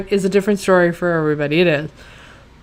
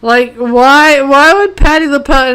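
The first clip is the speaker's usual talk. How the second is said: usual speaking voice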